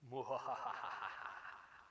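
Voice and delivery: breathy spooky villain laugh; scary laugh. Pretend. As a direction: laugh like a haunted house ghoul. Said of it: "give me, like, a really scary laugh".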